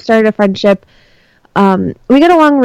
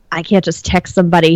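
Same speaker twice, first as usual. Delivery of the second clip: ordinary talk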